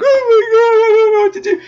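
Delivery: high, loud hammed-up sobbing wail; mock crying. Reviewer mocking Brandon breaking down, hammed-up crying wail. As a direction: wail it like a kid falling apart, played for laughs. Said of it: "He's all whimpering and crying".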